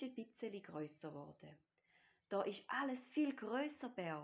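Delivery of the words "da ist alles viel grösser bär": high, loud exclamation; excited calling. A narrator's reading. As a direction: call to your friend in amazement. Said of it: "ruft der kleine Tiger". (laut und begeistert gerufen)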